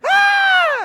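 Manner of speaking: very high, loud mock shriek; piercing acted coaster scream; imitated riders' scream. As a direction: scream like riders flying past.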